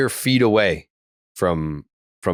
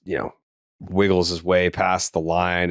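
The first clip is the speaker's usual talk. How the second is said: ordinary talk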